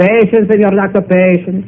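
high, lilting plea for patience; urging patience. Acted. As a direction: pitch it up high to placate him. Soothing an impatient doctor about gold.

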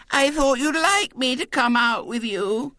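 put-on very high, lisping child's plea; plaintive little girl. A narrator's reading. Girl appealing to the unwelcoming boys, plaintive. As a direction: plead plaintively like a small girl. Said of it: "said Violet Elizabeth plaintively".

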